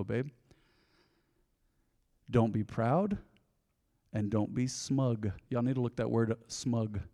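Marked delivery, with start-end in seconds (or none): 0.0-5.3 soft and slow, a mother's gentle warning; tender mother's warning. Retold or imitated. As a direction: lower your voice like a mother leaning close. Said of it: "she'd go".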